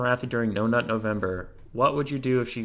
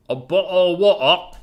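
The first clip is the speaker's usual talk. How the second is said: loud, higher put-on Chad accent; mock Chad accent; 6 semitones higher, 7 dB louder than usual. Shown as a demonstration. Streamer answering a viewer's request, exaggerated Chad accent. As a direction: swallow the consonants in a hammed-up Chad accent. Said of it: "in a true Chad accent".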